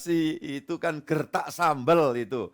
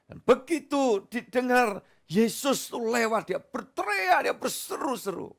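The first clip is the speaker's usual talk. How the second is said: higher, urgent preaching; 10 semitones higher than usual. Acted. Pastor telling of Bartimaeus crying out, urgent. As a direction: build the story of the blind man crying out. Preaching the blind man's cry.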